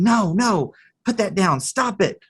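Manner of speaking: acted frantic telling-off; scolding mother. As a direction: imitate a mom rushing in to stop her child. Mom running at child, scolding.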